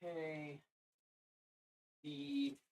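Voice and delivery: quietly reading labels under breath; muttering to self. Real, not acted. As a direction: murmur the part names to yourself. Murmuring part labels to self.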